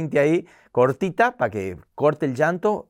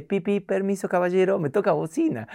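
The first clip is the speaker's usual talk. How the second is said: high, sweet courtesy; refined mother excusing herself, higher; polite lady; 6 semitones higher than usual. Retold or imitated. Polite rich mother passing.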